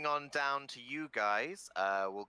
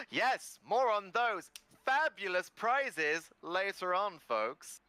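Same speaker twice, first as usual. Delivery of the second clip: announcer hype; 8 semitones higher, 3 dB louder, slower than usual. Acted. Presenter hypes prizes.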